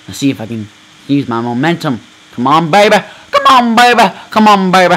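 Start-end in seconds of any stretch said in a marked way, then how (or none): 2.3-4.9 loud pleading push; will the cart forward; urgent cheering. Real, not acted. Gamer urging his vehicle on, loud cheering.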